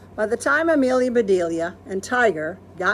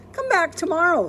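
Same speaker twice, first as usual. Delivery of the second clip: put-on much higher girlish call; child waving goodbye; little girl; 11 semitones higher, 4 dB louder than usual.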